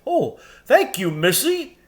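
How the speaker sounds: lightly voiced cheerful giant; grateful giant. A narrator's reading. Giant thanking Lucy, high and kindly. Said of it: "said Giant Rumblebuffin".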